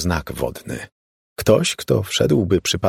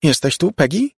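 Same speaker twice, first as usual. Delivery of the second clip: high, louder call into the empty room; anxious calling; 10 semitones higher, 3 dB louder than usual. A narrator's reading. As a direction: call out for her lost sister. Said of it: "zawołała Laura".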